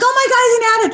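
hammed-up alarmed mother; panicked mom. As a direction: gasp it out like a frightened mom. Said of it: "I was like".

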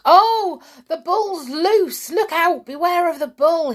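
high, loud cries of mock alarm; shouted warning. A narrator's reading. Dick enters into the bull joke, shouting. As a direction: join the prank with urgent shouting. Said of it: "he shouted".

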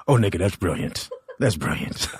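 low, hushed voice from the back of the room; crowd voice. Retold or imitated. Comedian imitates an audience member, low and hushed. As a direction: mutter it like a fan at the back.

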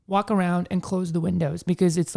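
normal talking voice

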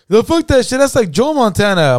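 high-pitched mock protest; retold disbelief, voice pitched well up; incredulous objection. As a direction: act out a friend scoffing at the claim. Friend rejects Montana being a state.

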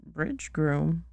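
ordinary delivery, nothing marked

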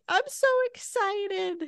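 put-on high, slow, teary little girl. Mother imitating her daughter's reaction to a brother, high and teary. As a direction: imitate a small child gushing tearfully.

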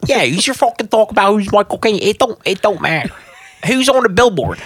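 hammed-up Cockney with a trashy Philly streak; Michael Caine.

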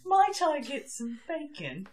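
put-on high, light posh girl voice, laughing between; a hint of prim upper-class politeness; posh Cambridge girl. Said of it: "this posh Cambridge girl".

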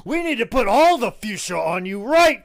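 bellowed, hammed-up boss order; outraged Vince. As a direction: build to a roar on the last words. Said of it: "Vince's like".

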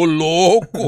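booming comic punchline shout; loud mock-astonished outburst; loud exclamation. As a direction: belt it out as the stunned reply. Teacher's stunned reply, joke punchline.

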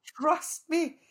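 high, loud, insistent friend voice; mock-take charge of a weepy pal; high caring friend impression; bossy friend. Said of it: "She's like".

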